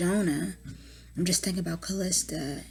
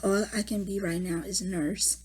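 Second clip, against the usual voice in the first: ordinary delivery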